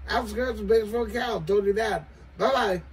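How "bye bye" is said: ordinary delivery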